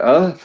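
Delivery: half-awake confused grunt; a dazed, sleepy "uh?"; groggy grunt. Retold or imitated. Waking up in a trough, a groggy grunt. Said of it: "I'm groggily, like".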